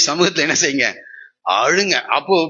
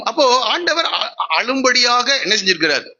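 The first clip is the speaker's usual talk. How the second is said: laughing; 7 semitones higher than usual. Real, not acted. Preacher laughing mid-question.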